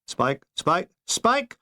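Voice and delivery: mild mock shout rising on the last Spike; calling out. Maunders calling Spike over headphones, mild rising shout. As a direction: call him urgently over the line. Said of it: "was shouting my headphones".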